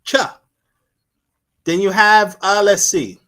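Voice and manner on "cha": Cha said high and clipped with an aspirated ch; cha sound. Shown as a demonstration. Teacher isolating the cha sound, high cha. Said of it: "They don't have it".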